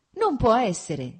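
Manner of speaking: loud, slow shout of disbelief. A narrator's reading. Vronsky cries out at his friend's gossip, shouting. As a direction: cry it out, not believing the news. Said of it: "gridò".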